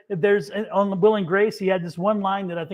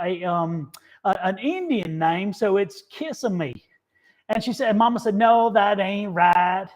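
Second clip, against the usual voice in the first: put-on Leslie Jordan's Southern accent; 2 semitones higher than usual. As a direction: tell the joke in a drawling Southern accent. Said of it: "just like that with that accent".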